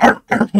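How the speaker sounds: loud, high mock barks; imitated barking. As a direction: yap like a dog, joking. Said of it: "he probably barked it".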